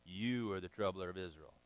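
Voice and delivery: low, soft dry retort; calm Elijah. Retold or imitated. Elijah calmly turning the blame back on Ahab. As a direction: answer the king coolly. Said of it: "Elijah says".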